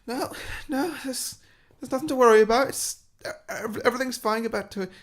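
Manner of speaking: high, jittery denial, brushing off worry; a rattled manager talking fast over his fear; nervous hotel manager. Retold or imitated. Hotel manager waves off the Ghostbusters, high and nervous.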